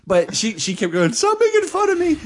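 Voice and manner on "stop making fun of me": very high squealed protest; indignant plea. Retold or imitated. Girlfriend protesting at being laughed at, indignant. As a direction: imitate a wounded girlfriend in a squeaky voice.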